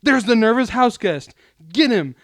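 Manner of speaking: high, loud call to the chase; kid sounding alarm. Retold or imitated. Young detective spots the nervous guest, shouting the alarm. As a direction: shout like a kid spotting his target. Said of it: "every time Pool saw him, he'd be like".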